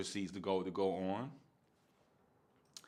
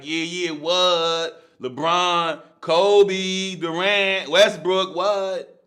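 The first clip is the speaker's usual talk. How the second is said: loud, high hype, each name shouted higher; hyped roll call; 9 semitones higher, 14 dB louder, much slower than usual. Retold or imitated. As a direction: call out the stars like a hype man. Hyping up a dream team list.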